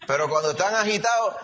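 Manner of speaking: usual speaking voice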